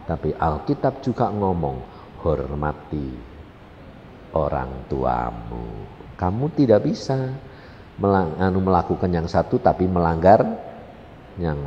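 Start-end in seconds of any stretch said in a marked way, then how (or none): 2.3-5.9 solemn. Retold or imitated. Scripture quoted solemnly.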